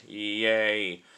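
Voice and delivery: ordinary delivery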